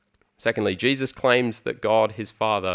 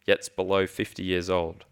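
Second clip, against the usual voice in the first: usual speaking voice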